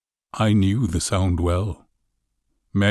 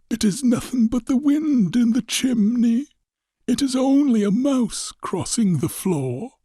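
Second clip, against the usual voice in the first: put-on high, thin old man's voice, trying to calm himself; an old man soothing his own fear; frightened old man. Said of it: "He had been saying to himself".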